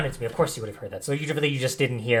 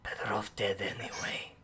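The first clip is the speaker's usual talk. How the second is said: weak, broken whisper, very slow; pained hoarse whisper; 5 dB quieter, slower than usual. Acted. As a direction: barely whisper it, hopeless and hurting. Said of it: "very pained, hoarse, and weak voice".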